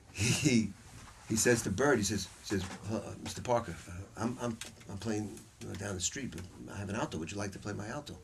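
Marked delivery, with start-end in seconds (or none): 2.9-8.2 timid request. Retold or imitated. Young player shyly approaches his idol.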